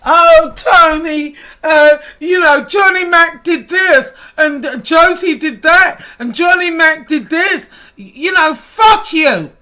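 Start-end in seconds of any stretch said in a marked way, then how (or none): 0.0-7.7 loud, high whining parody; higher, louder whiny mockery of a tattletale; mock whining tattle. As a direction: mimic a crybaby snitching, hammed up. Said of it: "you fucking little whiny bitch".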